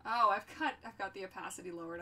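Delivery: ordinary talk, nothing marked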